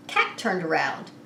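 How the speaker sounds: ordinary delivery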